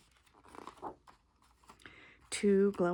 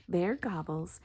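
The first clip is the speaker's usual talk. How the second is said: usual speaking voice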